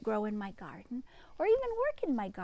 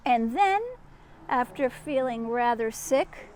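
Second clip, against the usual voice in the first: bright storybook read-aloud; reading to children. A narrator's reading. Reading Peter Rabbit aloud to a child, storybook voice. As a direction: tell it like a bedtime story for a child.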